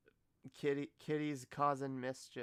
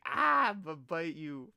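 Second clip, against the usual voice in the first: louder, hammed-up cat threat; mock angry cat; 4 semitones higher, 10 dB louder than usual. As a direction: play the grumpy biting cat, half-joking. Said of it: "He's just like".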